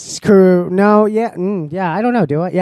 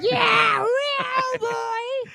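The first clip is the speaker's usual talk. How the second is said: high yell; much slower than usual. Acted. Yelling the catchphrase back.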